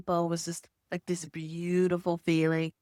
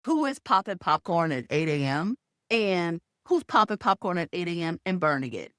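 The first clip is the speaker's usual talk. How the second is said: ordinary talk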